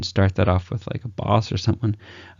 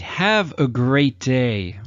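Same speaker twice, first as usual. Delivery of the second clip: slow, drawn-out and bored; worn-out farewell; 3 semitones higher, much slower than usual. Retold or imitated. Coach mocking an overused goodbye, slow and bored. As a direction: drag it out like a tired cliché. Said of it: "how many times have we heard that before".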